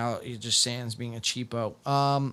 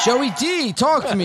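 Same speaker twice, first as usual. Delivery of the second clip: excited greeting; 10 semitones higher, 7 dB louder than usual. Real, not acted. Host hails arriving guest.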